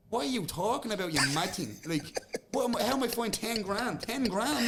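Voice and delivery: high, incredulous protest; retold outrage. Fighter replaying his protest at the fine. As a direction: pitch it up in disbelief at the sum.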